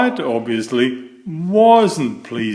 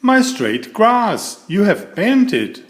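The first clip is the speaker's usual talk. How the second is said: distressed; 7 semitones higher than usual. A narrator's reading. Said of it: "cried Mister Fussy".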